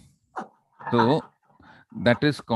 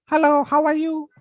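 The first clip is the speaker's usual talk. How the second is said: very high, louder squeaky hello; shrill voice; 6 dB louder than usual. Shown as a demonstration. Teacher demoing a shrill voice to the class. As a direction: pitch it way up, shrill. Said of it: "What is shrill?".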